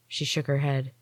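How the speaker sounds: ordinary delivery, nothing marked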